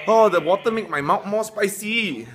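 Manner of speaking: surprised. Real, not acted. Water makes spice worse.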